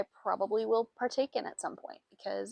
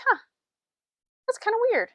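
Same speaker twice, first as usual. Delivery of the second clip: high, puzzled "huh"; baffled mid-knit, voice jumping up; puzzled surprise; 7 dB louder, faster than usual. Retold or imitated. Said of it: "I'm like".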